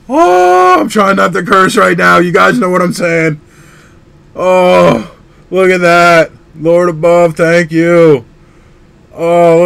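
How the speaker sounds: loud, high, breathless gushing; thrilled. Real, not acted. Collector raving to viewers about his hit, overjoyed. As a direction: let the joy spill over, barely holding back curses. Keeps reacting to the card.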